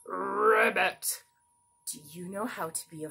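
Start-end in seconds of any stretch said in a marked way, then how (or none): 0.1-1.2 frog ribbit. Acted. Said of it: "our green frog who says".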